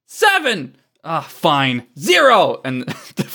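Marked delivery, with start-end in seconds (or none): 0.0-0.8 very high call; gleeful high countdown call; countdown shout. Acted. Villain calls out a countdown. 2.0-2.6 high, loud final call; loud, high-pitched finish; countdown shout. Acted. Villain abruptly ends the countdown.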